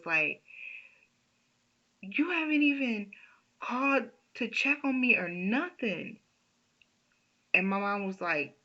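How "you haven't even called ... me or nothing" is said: higher, upset complaint; mad at mom. Retold or imitated. Patient scolding her mom on the phone, higher and upset. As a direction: whine it at your mother, hurt and mad. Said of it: "I was so mad".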